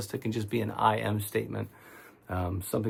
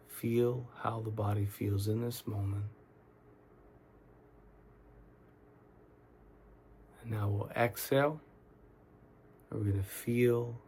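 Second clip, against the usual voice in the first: soft, unhurried meditation guide with long pauses; calm, quiet guiding voice; guided meditation; 4 dB quieter than usual. Real, not acted. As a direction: talk them gently through tensing and letting go, leaving space between phrases. Leads guided relaxation meditation.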